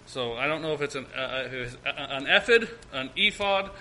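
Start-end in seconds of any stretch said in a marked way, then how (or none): 2.0-2.7 "ephod" opening like "eff", ending in a weak id. Shown as a demonstration. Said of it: "I'm not sure how to say it". 2.9-3.7 "ephod" with long ee and a clear fod. Shown as a demonstration. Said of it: "I'm not sure how to say it".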